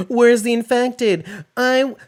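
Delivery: high, loud mock complaining fan; whiny fan complaint. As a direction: whine like an impatient viewer. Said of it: "the same complaint most people had".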